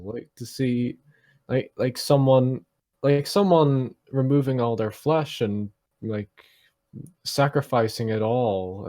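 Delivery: normal talking voice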